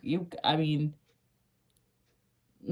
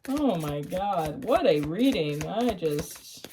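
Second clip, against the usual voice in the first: usual speaking voice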